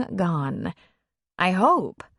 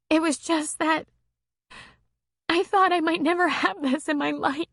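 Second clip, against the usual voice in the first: lightly voiced woman, higher, moved; a hint of a tearful woman, higher; her voice; 10 semitones higher than usual. A narrator's reading. Female character voice.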